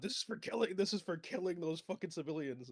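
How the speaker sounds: high-pitched mock battle cry; hammed-up, high-pitched vengeful yelling; acted yell. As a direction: yell it out in character, not at full volume. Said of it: "I just yell out".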